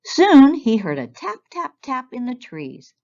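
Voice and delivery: ordinary delivery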